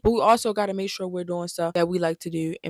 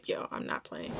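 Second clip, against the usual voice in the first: whispered aside; 13 semitones lower, 13 dB quieter than usual. Real, not acted. Tired aside about needing a nap.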